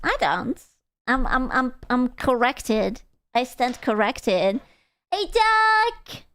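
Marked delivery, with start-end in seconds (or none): 5.1-6.2 calling out. Real, not acted. Calling out to someone.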